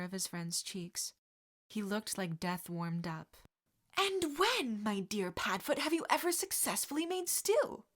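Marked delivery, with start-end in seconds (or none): 4.0-7.8 high, arch teasing question. Acted. James mocking Sirius's cooking, arch and teasing. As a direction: needle his friend.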